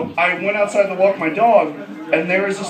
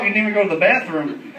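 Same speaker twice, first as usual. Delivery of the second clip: ordinary talk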